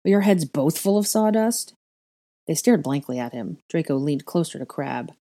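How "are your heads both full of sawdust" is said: high, exasperated question; scornful, raised-voice jab; snapped. A narrator's reading. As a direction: scold them with rising pitch. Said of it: "Draco snapped".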